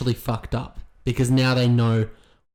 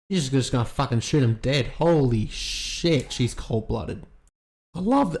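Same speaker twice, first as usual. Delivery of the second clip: ordinary talk, nothing marked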